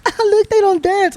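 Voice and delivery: high, slow mocking girl voice. Girls at the party taunting him, high mocking voice. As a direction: sneer it like a teasing girl.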